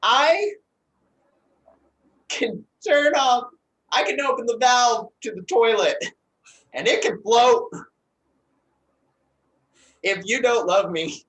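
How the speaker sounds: loud, high, menacing threat; high threatening voice. Acted. New choice on threat.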